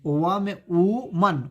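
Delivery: वा में वुमन drawn out slowly, वुमन as "woo-mun"; slow careful pronunciation. Shown as a demonstration. Teacher slowly repeats वुमन for students, careful pronunciation. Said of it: "इसे वुमन पढ़ते हैं".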